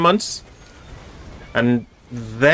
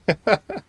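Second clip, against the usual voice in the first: short laugh; 8 semitones higher, 4 dB quieter than usual. Real, not acted. Streamer laughs at quiet chat.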